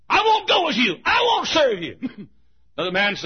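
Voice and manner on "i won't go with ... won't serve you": high, loud, tearful refusal; a girl screaming she won't serve; defiant cry. Acted. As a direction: shout your refusal, near tears. Slave girl defying bidder.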